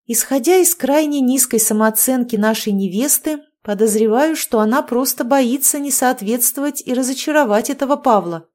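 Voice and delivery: usual speaking voice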